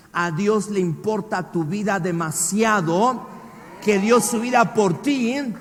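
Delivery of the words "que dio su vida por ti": loud, higher, slow fervent preaching; preacher's fervent shouted climax; preaching at full force. Acted. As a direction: proclaim it to the whole church.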